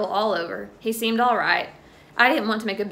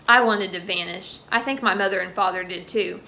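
usual speaking voice

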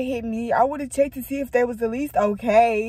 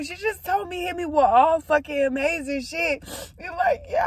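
high, tearful gushing; overcome with emotion; 6 semitones higher than usual. Real, not acted. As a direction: pour it out through happy tears. Moved to tears by friend's words.

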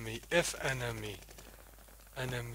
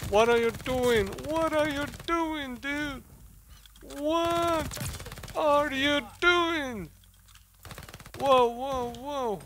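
high, loud screaming outrage; raging gamer; 12 semitones higher, 5 dB louder than usual. Real, not acted. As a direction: shriek at the teammates. Screaming at teammates.

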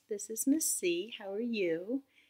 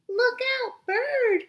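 much higher, louder alarm; panicked cry; 12 dB louder, slower than usual. Acted. Snails warning each other about the bird, panicked. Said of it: "cried the snails in panic".

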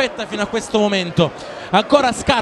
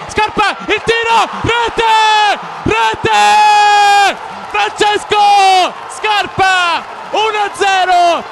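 roaring goal call, high and loud; breathless italian goal commentary; 6 semitones higher, 4 dB louder, much slower than usual. Real, not acted. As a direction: scream the goal, stretch the name. Goal scored, commentator erupts. (telecronaca gol urlata e senza fiato)

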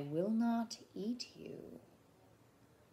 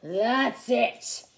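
loud, growled outburst; snarling; 3 semitones higher, 9 dB louder than usual. A narrator's reading. Theodore snarling at the poking boy, loud and fierce. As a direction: snarl it loudly at the end of patience. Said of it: "Theodore snarled".

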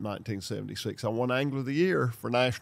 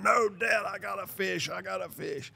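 put-on excited young son; 7 semitones higher, 4 dB quieter than usual. Son voiced, reeling in fish.

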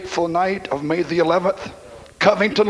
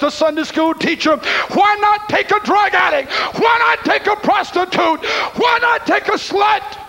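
preaching at full force; 6 semitones higher than usual. Acted. Preacher pleading with God over his dying granddaughter, shouting. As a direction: cry it out to God from the pulpit.

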